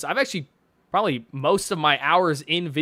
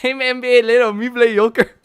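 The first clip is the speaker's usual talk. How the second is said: loud, higher mock-Mexican accent; hammed-up Mexican accent, pitched up; M K Leo; 6 semitones higher, 6 dB louder than usual. Streamer mimics his victory taunt to Thomas, mock Mexican accent. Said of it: "I'd be like".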